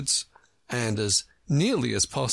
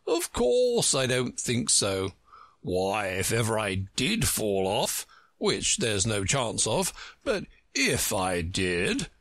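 slow, self-important character voice; pompous egg, slow and deliberate; Humpty Dumpty, pompous. Acted. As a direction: insist grandly, drawing words out.

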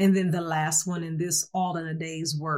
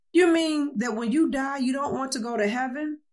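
higher-pitched, disbelieving question; lightly voiced shock, pitch raised; mildly aghast; 7 semitones higher than usual. A narrator's reading. Priest questioning a man who refused heaven, mildly aghast. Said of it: "The priest is aghast".

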